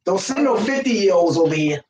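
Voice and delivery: ordinary talk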